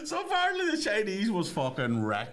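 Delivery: talking through high laughter; laughing. Real, not acted. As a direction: laugh while telling it.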